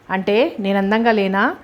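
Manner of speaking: plain reading voice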